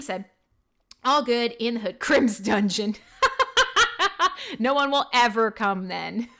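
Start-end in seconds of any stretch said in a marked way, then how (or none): 3.2-4.5 high loud laugh. Real, not acted. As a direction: burst out laughing. Laughing at his joke.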